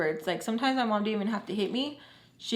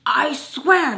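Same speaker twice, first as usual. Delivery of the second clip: loud pleading; 6 semitones higher, 9 dB louder than usual. Retold or imitated. Pleading with the bailiff.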